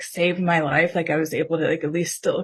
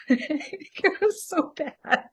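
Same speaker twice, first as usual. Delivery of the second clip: high, helpless laugh through the words; 9 semitones higher, 4 dB quieter, much slower than usual. Real, not acted. Woman confessing her past behaviour to the interviewer, laughing. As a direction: laugh at yourself, slow and breaking up.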